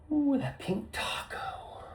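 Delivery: slow breathy high whisper; lewd whisper. Pretend. Joking to viewers about panties, slow lewd whisper. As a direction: whisper it like a naughty secret.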